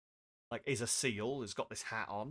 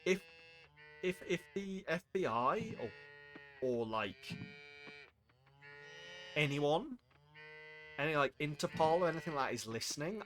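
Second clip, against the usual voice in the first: ordinary talk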